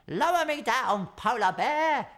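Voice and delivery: high, loud call to the bear; a penguin urging his friend; crying out. A narrator's reading. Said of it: "he cried".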